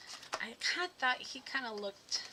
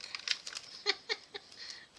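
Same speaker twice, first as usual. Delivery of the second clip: giggle; 12 semitones higher than usual. Real, not acted. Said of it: "I don't know where that accent just came from".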